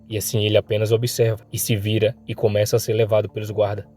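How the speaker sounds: ordinary delivery, nothing marked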